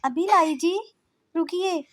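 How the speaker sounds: high, loud reply; calling back. Acted. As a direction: shout back to husband. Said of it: "Aaradhya कहती है".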